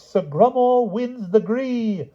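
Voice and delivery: very slow, high, drawn-out herald cry; a herald crowning the day's champion; herald's proclamation. Acted. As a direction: stretch every word out like a herald.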